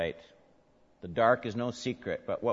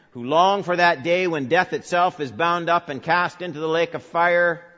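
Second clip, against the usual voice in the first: louder, raised sermon voice; preaching at full force; 7 semitones higher, 9 dB louder than usual. Acted. Preacher addressing the bereaved in the church, loud and raised. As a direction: call out to all who suffer.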